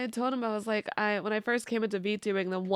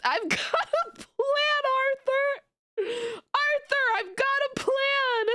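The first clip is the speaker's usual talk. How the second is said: quoting Dutch through giggles, much higher; laughing Dutch quote. Retold or imitated. Streamer quoting a Red Dead line, laughing. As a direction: try to say it but keep cracking up. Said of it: "every time I hear".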